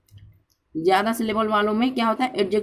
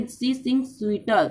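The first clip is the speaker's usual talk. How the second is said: slow example read; much slower than usual. Shown as a demonstration. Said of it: "लेकिन हम देखिए एक शब्द जोड़ के हम बनाएंगे".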